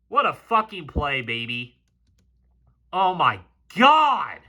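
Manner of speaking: ordinary delivery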